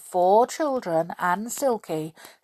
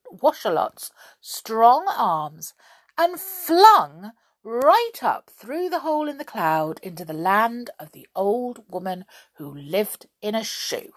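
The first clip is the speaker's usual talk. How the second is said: ordinary delivery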